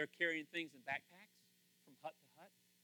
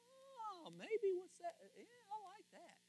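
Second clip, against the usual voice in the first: wondering voice; 8 dB quieter, slower than usual. Pretend. Imagined voice behind the veil.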